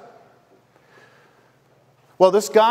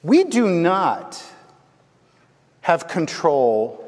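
slow emphatic; slower than usual. Real, not acted. Preacher stresses the lesson.

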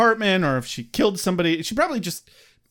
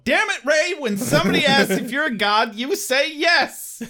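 higher, louder exasperated scolding; exasperated yell; 11 semitones higher, 3 dB louder than usual. Acted. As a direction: shout at him in frustration. Scolding Ray in Ghostbusters quote.

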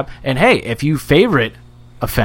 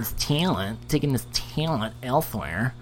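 softer mocking whiny imitation; 11 dB quieter than usual. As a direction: mimic his whiny voice back at him. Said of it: "sounded like his whiny voice".

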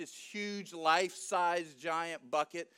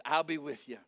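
low, unmoved promise; calm repeat; 6 semitones lower than usual. Acted. Angel ignoring Gideon's objections, low calm assurance. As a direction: restate it evenly, pitch dropped.

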